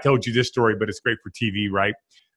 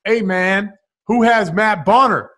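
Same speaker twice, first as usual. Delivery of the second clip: raised, teasing call-out; Paul Pierce stirring; 6 semitones higher than usual. Retold or imitated. Pierce at halftime needling the locker room, teasing call. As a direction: poke the bear on purpose. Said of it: "It's like".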